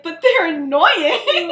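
loud, slow, laughing protest; Lily protesting loudly through a laugh; laughing shout. Acted.

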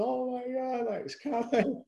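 half-scared thrill as it nears; excited panic. Retold or imitated. Shark approaching, heart racing.

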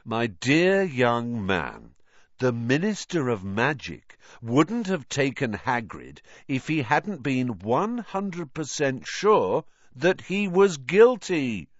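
slow, rising patronizing explanation; condescending. A narrator's reading. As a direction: explain the obvious to a slow child. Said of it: "explaining that one and one made two".

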